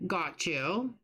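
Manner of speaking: ordinary talk, nothing marked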